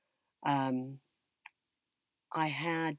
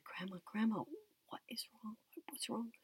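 hushed, anxious question rising higher; worried whisper; 7 semitones higher, 9 dB quieter than usual. Retold or imitated. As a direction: whisper your alarm, then let it climb. Worried grandchild asks at table.

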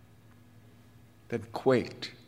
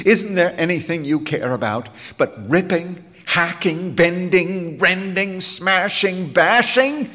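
rising, louder outburst building to a high shout on bashing; crying out; 8 semitones higher, 5 dB louder than usual. A narrator's reading. Bandleader exploding at the wrecking boy, higher and louder. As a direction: fling each verb at him, climbing higher. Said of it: "he cried".

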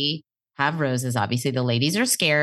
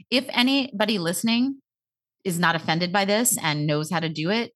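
ordinary talk, nothing marked

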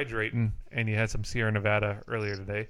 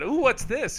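curious; 11 semitones higher, 4 dB louder, slower than usual. Retold or imitated. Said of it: "I said".

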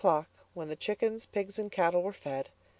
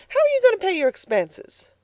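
much higher, louder challenge; demanding; 6 dB louder than usual. Acted. Mother challenging Elnora about money, higher and louder. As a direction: throw the question at her.

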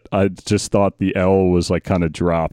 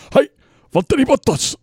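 high-pitched mock Finnish accent, almost Japanese; hammed-up emphatic Finnish greeting; imitating Valtteri Bottas. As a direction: squeal out a Finnish-accented introduction. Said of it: "It's almost like Jap-, Japanese".

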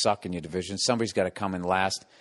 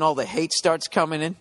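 normal talking voice

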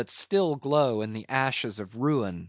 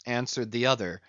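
ordinary delivery, nothing marked

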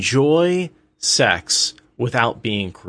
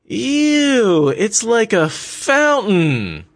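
put-on much higher girly voice, slow drawn-out "fountain"; girlish disgust; 13 semitones higher, 4 dB louder, much slower than usual. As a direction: squeal it up high like the grossed-out girlfriend. Girl's voice heard through door.

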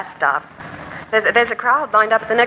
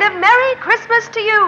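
biting mock greeting, pitched up; sarcastic retort; 12 semitones higher than usual. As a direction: throw the good wishes back at him, stung. Wife snaps back in a quarrel.

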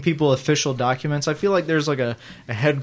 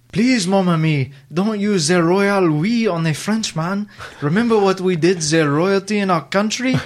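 put-on French accent; 5 semitones higher, 4 dB louder than usual. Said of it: "can you do the accent".

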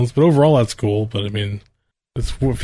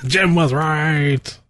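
slow teasing drawl; 4 semitones higher, much slower than usual. Pretend. Teasing repeat of running joke.

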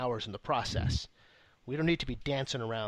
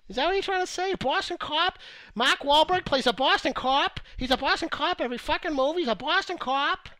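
high, loud mock Boston accent; 9 dB louder than usual. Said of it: "what was the time he put on that Boston accent".

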